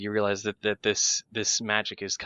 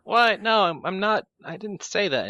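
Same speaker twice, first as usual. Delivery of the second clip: higher, louder flustered protest; startled denial; 12 semitones higher, 7 dB louder than usual. Acted. Character caught by mind reading, startled flustered denial. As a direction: blurt it out, caught off guard and stumbling. Character denies an overheard private thought.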